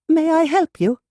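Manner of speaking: high, bright and eager offer of help. A narrator's reading. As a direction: greet the visitor cheerfully, pitched high. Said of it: "Maeve asked brightly".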